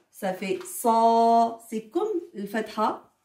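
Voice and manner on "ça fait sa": sa with the a held very long; long vowel, held. Shown as a demonstration. Teacher shows the alif's long a. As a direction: stretch the a in sa far out. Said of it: "on a le alif".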